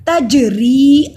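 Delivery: तजरी with a long final ee; slow, loud recitation, bouncing the j of तजरी; lengthened ree. Shown as a demonstration. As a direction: stretch the री at the end of तजरी. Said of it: "री को लंबा करेंगे".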